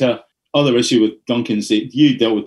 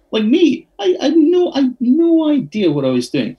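high-pitched, squeaky disbelief; incredulous self-mockery. Real, not acted. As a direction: pitch leaps up, marvelling at your own cluelessness. Mocks own cluelessness at the job.

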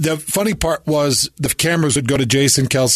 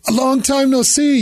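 much higher, mock girlish greeting; imitating crying girl; 12 semitones higher than usual. Ex-girlfriend greets him in a bar, high mimicry. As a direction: pitch it way up as the ex. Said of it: "it's this crying girl".

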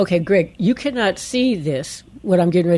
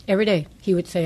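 usual speaking voice

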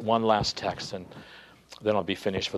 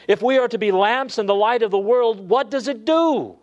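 higher, louder, driving pulpit voice; rising preacher's cry, higher and louder; full force preaching; 9 semitones higher, 4 dB louder than usual. Acted. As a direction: build the fervour and press the congregation.

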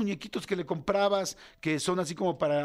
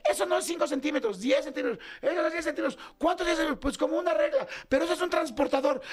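réplicas agudas y alteradas; riña familiar imitada; 9 semitones higher than usual. Retold or imitated. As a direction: imita una discusión absurda, en tono alto. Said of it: "otro problema familiar". (pleito familiar exasperado)

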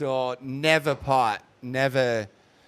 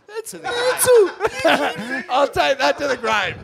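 laughing; 12 semitones higher, 5 dB louder than usual. Real, not acted. Laughing over beating Dorian.

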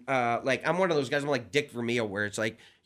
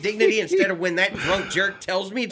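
high, louder exasperated outburst with laughter breaking in; comic snapping at the usher shining a flashlight; 6 semitones higher, 5 dB louder than usual. Retold or imitated. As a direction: lose it at the usher, half laughing. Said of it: "I finally snapped at him".

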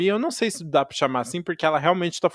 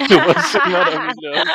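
laughing; 3 semitones higher, 7 dB louder than usual. Real, not acted. Laughing while praising the song.

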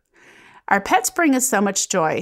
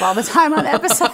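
laughing through the admission; 9 semitones higher, 4 dB louder, faster than usual. Real, not acted. Laughing as she tells her coworker she cries on air. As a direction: giggle while confessing you cry on air.

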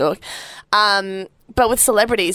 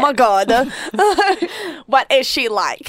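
mock gossip; 5 semitones higher than usual. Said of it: "Ashton talks like that too".

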